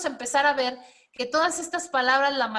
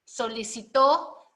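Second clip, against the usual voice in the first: "solicitó" slow, rising onto a long TÓ; stress on final syllable; slower than usual. Shown as a demonstration. Teacher introducing an example, "solicitó" slowly stressed on TÓ. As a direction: land on the last syllable of "solicitó". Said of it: "el solicitó es dependiendo".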